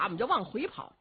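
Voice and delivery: usual speaking voice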